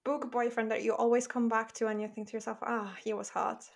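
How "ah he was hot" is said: normal talking voice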